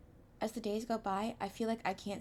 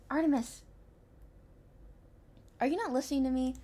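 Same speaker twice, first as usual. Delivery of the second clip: high, loud demanding call; 6 semitones higher, 4 dB louder than usual. Acted. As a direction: demand his attention sharply. Violet demands attention.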